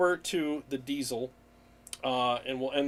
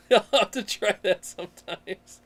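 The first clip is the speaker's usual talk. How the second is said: words through real laughter, a bit louder; laughing while talking; 5 semitones higher, 5 dB louder than usual. Real, not acted. Streamer answering a funny chat comment, laughing. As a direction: still giggling as you answer.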